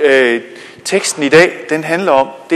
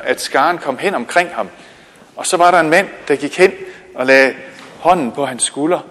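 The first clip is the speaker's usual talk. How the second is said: ordinary talk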